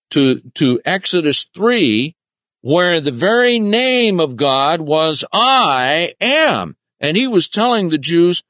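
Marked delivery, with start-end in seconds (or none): 5.3-6.8 "I am" lifted high and drawn out; emphatic I am. Shown as a demonstration. Preacher stressing "I am" to the congregation, emphatic and raised. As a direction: lay weight on "I am" as God's very name. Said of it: "Now notice the emphasis of this".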